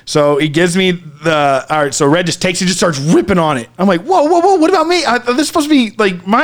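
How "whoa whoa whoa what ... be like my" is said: much higher, indignant whoa-whoa; high protest. Retold or imitated. Protesting as his friend takes the first hit, squealing. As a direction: squeal in outrage at being skipped.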